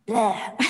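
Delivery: imitated retching "bleh"; comic fish vomit sound; spitting-out noise. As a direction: spit it out with a mock retch.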